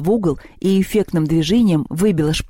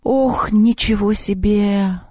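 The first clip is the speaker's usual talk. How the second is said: slow, drawn-out higher-pitched wonder; amazed exclamation; 4 semitones higher, much slower than usual. A narrator's reading. Boy watching a sword fight, drawn-out amazement. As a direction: marvel aloud, stretching the words. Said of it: "воскликнул Севка".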